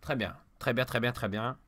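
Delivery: low, very soft repeated approval; subdued muttering. Real, not acted. Player settling down after losing, subdued muttering. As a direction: murmur it under your breath. Regaining calm after rage.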